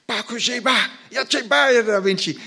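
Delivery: put-on high, loud child's voice bossing the crowd; a small boy with hands on hips telling grown-ups off; three-year-old boy in Hausa. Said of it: "he says in perfect Hausa".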